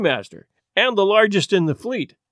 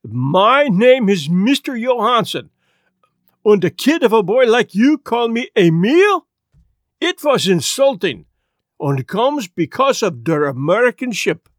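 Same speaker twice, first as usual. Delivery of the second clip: Swedish sailor demanding respect; offended Swedish seaman; Swedish accent; 3 semitones higher than usual. Acted. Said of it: "the Swedish sailor exploded".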